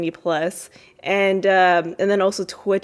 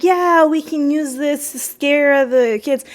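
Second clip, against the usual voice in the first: higher, slower, louder glee; gleeful scheming; 7 semitones higher, 5 dB louder, slower than usual. Retold or imitated. Son finding the drill for the haunted house, gleeful. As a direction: relish the spooky idea. Theo finds drill, voiced.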